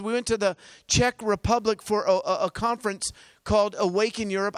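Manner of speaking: usual speaking voice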